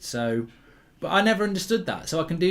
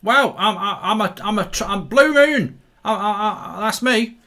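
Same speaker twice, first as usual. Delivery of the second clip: put-on high, stammering Cockney wide boy; Cockney, pitched up, stumbling over the words; mock Cockney accent; 5 semitones higher, 3 dB louder than usual. Wide boy claiming Blue Moon, mock Cockney. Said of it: "no way to speak about Cockneys".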